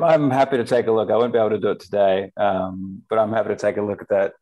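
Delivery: ordinary talk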